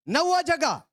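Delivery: emphatic announcement. Real, not acted. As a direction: call it out high to the congregation. Preacher announces next point with force.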